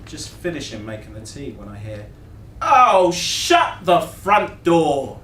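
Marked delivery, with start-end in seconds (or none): 2.6-5.2 loud, high, stretched-out astonishment; loud amazed exclamation. A narrator's reading. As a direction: holler it in amazement, drawing out each word. Loud American exclaims over records.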